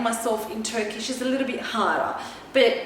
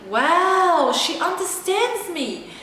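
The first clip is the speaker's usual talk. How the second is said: awed surprise; 6 semitones higher, much slower than usual. Retold or imitated. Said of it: "I was so shocked".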